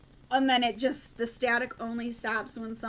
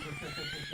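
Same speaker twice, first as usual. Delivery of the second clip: sudden high squeal of laughter; 9 dB quieter than usual. Real, not acted. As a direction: let out a high, delighted laugh. Laughs after remembering name.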